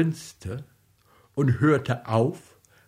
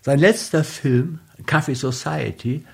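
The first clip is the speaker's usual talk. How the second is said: brisk, louder program announcement; radio announcer; 4 dB louder, faster than usual. Acted. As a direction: read the outro like a radio host.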